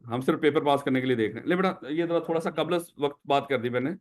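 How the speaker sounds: usual speaking voice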